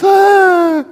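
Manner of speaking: high imitated baby cry; baby's wail. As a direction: mimic a bawling baby in one long wail. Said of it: "and he's wailing".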